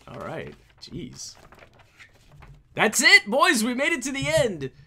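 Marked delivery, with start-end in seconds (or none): 2.7-4.7 triumphant. Real, not acted. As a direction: call it out in triumph. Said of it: "triumphant wrap-up".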